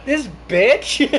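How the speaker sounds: high, loud, drawn-out disbelief; real outrage. Real, not acted. Player reacts to the witness's insult, loud disbelief.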